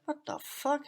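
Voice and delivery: mock surprise. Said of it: "And I was like".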